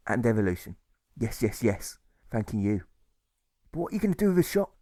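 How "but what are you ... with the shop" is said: higher, quick anxious asking voice; a worried man blurting his question; deserter's question. A narrator's reading. Deserter pressing Alfred about the shop, higher and quick. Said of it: "the deserter asks".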